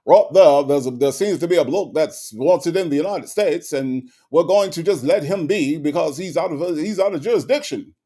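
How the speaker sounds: a lightly voiced British accent, punchy start; Idris Elba British accent. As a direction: channel a London copper with a British accent. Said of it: "get my inner Idris Elba out".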